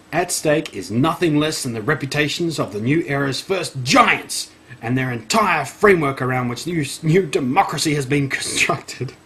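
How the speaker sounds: hammed-up emphasis on key words; theatrical read-aloud; dramatic article reading. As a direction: read it with mock gravity, punching the last word.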